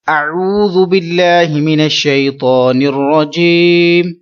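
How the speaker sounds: slow, drawn-out Arabic recitation; the teacher opens with a slow recitation; Quranic recitation. Shown as a demonstration. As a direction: recite it slowly and melodically. Recites before the lesson.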